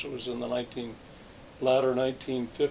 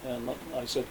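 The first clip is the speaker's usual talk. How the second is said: ordinary delivery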